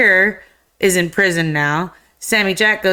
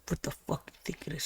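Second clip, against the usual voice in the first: much softer, fast, nearly breathless threat; menacing whisper; 20 dB quieter, much faster than usual. Retold or imitated. She answers with gun drawn.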